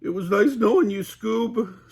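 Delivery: high loud Shaggy, not whispered; mock whisper as Shaggy. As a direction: say goodbye in terror. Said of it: "Shaggy whispered".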